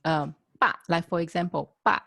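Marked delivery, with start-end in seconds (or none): 0.6-0.7 strong, short "ba"; falling, emphatic "ba"; fourth tone. Shown as a demonstration. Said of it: "is a strong one". 1.8-2.0 strong, short "ba"; falling, emphatic "ba"; fourth tone. Shown as a demonstration. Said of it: "a strong emotion".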